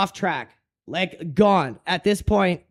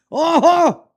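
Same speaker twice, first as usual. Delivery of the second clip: frat bro; 9 semitones higher, 5 dB louder than usual. Real, not acted. Outburst after crash.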